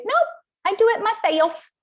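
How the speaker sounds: put-on high childish "I do it myself"; toddler insisting. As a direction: squeak it like a stubborn little kid. Quoting herself as toddler.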